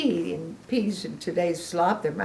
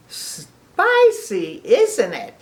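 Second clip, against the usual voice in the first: gleeful pig; 3 semitones higher, 7 dB louder, much slower than usual. Acted. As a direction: tease with relish. Piggy asking.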